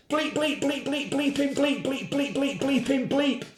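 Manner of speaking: high bleeping like a censor tone; censor bleeps. Acted. Woman swearing on TV, voiced as high bleeps. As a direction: bleep out every swear word. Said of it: "the only sound obscenities".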